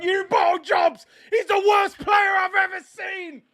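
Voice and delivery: high, exasperated yelling; outraged shout. Real, not acted. Fan after Jordan Ayew scores, outraged high shout. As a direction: yell in disbelief at the team, pitch soaring. Rival's worst player has just scored.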